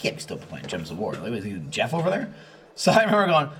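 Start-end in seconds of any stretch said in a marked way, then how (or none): 0.0-2.3 inner thought. Retold or imitated. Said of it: "in my head I'm like".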